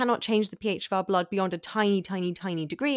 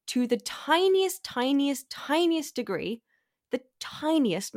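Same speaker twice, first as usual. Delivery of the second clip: slow, higher-pitched punch on every "tiniest"; stress on TINIEST; 5 semitones higher, slower than usual. Real, not acted. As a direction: draw out "tiniest" each time, lifting the pitch. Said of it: "I'm emphasizing this word considerably".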